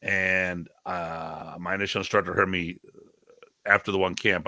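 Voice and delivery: usual speaking voice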